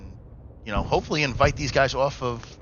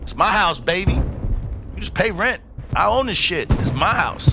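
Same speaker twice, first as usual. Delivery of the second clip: high, loud cocky gloating; hammed-up swagger, slow on the rent line; mock taunt; 7 semitones higher, 4 dB louder than usual. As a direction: brag like you own the place. Taunting on the player's behalf.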